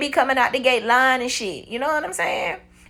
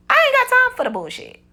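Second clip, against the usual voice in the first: much higher and louder, fed up, brushing him off; retold exasperated outburst; 13 semitones higher, 3 dB louder than usual. Woman telling off a married coworker, high exasperated outburst. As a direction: pitch jumps up high on a fed-up dismissal.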